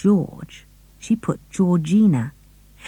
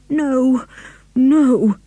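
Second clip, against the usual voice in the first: desperate; 7 semitones higher, 3 dB louder than usual. A narrator's reading. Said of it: "in a low, desperate voice".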